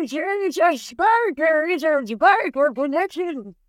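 put-on high, slow old-timey prospector. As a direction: mumble it like a grizzled gold miner. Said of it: "say it again in the prospector voice".

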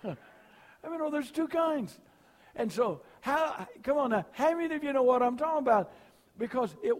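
loud, high revival preaching that builds and rolls; preaching at full force. Acted. Pastor rousing the revival congregation, at full force.

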